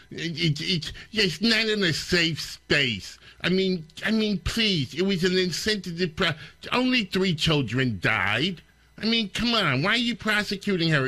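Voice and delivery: high, sneering mock of the reporter; sarcastic mimicry. Host mocking the reporter's logic, high sarcastic sneer.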